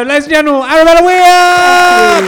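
loud, high send-off building to a long-held shout; rousing announcer shout. Real, not acted. Host thanking guest before the audience, rousing shout. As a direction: shout the name out for applause, drawing it out.

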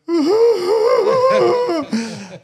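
very high, loud wailing cry; very high mock wail. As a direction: burst into frightened sobs. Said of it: "starts going".

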